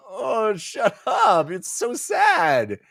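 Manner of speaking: high, slow mock sarcasm; sarcastic drag. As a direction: drag it out like an exasperated teen. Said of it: "He's like".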